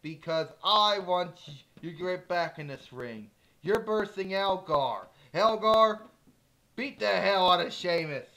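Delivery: loud, high furious yelling; furious villain. Acted. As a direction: scream orders like a raging boss. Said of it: "Diva Talk's getting pissed off".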